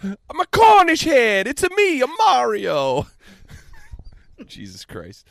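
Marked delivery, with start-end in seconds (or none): 0.0-3.1 hammed-up Italian accent, singsong and high; mock Italian accent. Reprising the Cornish Hen as Mario, Italian accent. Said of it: "I don't know why I went Italian with it".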